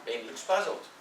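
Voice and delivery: usual speaking voice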